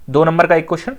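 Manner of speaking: usual speaking voice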